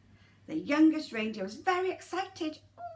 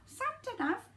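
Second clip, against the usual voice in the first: higher repeat; 10 semitones higher, 3 dB quieter than usual. Shown as a demonstration. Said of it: "repeat of Santa nav".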